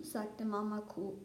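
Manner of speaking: ordinary delivery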